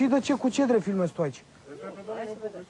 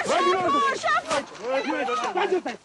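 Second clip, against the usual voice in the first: angry shouting order; 7 semitones higher, slower than usual. Real, not acted. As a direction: yell at him to come and sit down. Boss orders reporter to sit.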